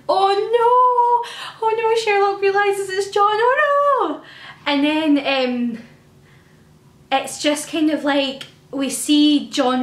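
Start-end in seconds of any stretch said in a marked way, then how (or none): 0.1-4.2 high, loud, anguished cries of oh no; distressed oh no. Retold or imitated. Fan reliving the bonfire scene, high anguished squeals. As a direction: squeal in panicked dismay as it dawns on him. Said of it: "I was like".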